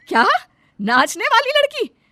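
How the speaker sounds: be stunned and scandalised; shocked disbelief. Acted. Mother hears her son wants to marry a dancer, shocked high cry.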